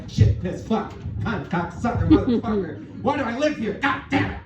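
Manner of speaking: high, strained burst of cursing; hammed-up Tourette's-style outburst; uncontrollable swearing. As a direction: spit out curses as if the cold just hit. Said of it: "start swearing your ass off uncontrollably".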